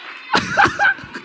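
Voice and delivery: sudden high laugh; quick bright laugh. Real, not acted. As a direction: burst out laughing. Laugh after preaching line.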